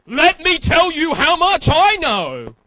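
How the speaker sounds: loud, slow, pompous bragging voice; boastful vomiter. Acted. Mock salesman showing off to a customer, loud and slow. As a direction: brag like a salesman who won't stop talking. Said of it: "Have you ever seen a vomiter?".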